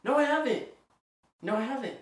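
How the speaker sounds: insistent denial. Real, not acted. Insists denial after being doubted.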